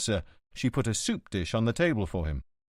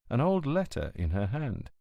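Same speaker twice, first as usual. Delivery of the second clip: ordinary delivery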